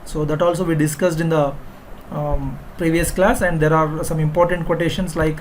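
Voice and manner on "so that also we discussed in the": normal talking voice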